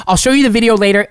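ordinary talk

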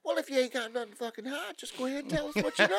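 high, put-on coaxing cop; mock cop voice. Warning listeners about interrogators, mock cop voice. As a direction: sound like a cop sweet-talking a suspect. Mocking how police talk suspects down.

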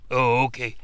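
normal talking voice

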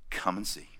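Jesus gently inviting the disciples; soft invitation. Acted.

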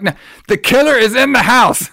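high, shouted alarm; acting out a desperate yell to flee; frantic warning. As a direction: build to urgent, high-pitched shouting. Sergeant warns her killer is inside.

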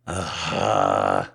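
low, loud groan; frustrated groan. Acted. Cuffed man losing his game, frustrated groan. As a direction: groan out the exasperation.

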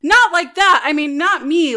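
high, hasty backpedalling; flustered denial. Acted. Lou denies fancying the newcomer, flustered.

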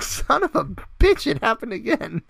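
very high frustrated curse; mock frustration. Locked out a second time, high frustrated curse. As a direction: groan it out in disbelief.

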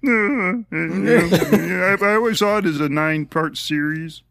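halting, laughing George Lucas; George Lucas impression. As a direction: stammer through it like Lucas. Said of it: "my George Lucas is".

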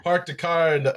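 put-on botched Boston accent turned Minnesotan; accidental Minnesota accent. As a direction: try Boston, land in Minnesota. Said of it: "I went Minnesota there".

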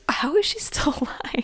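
breathy disbelieving whisper; incredulous whisper. Pretend. Friend mock-whispers about Emily surviving, incredulous whisper. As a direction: hush it, amazed she survived. Friend imagines others' reaction.

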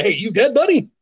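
light teasing call, pitch jumping up on buddy; mock chummy call. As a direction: poke a dead guy, chummy. Mock greeting to a dead man.

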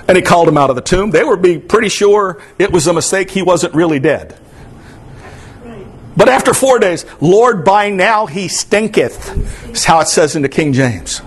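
Sunday preacher lifting his voice to the congregation; impassioned preaching. Acted. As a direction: preach it with rising fervor. Preaching, pitch raised for many lines.

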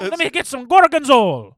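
put-on Italian-American accent. Italian-American impression bit.

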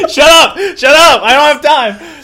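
high, very loud, laughing protest; exasperated shout. Real, not acted. Storyteller short of time silencing the laughing listener, exasperated shout. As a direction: yell over the laughter to shut them up.

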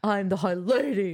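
slow, hammed-up self-announcement; mock-grand, drawn-out delivery; put-on Feyre voice. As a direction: stretch it out in a mock character voice. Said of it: "that's how you talk".

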